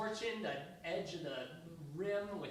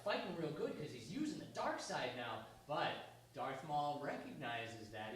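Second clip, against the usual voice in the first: ordinary talk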